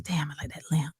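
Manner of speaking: low, soft aside to oneself; muttered private thought. Retold or imitated. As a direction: think it aloud quietly. Private thought, not yet said aloud.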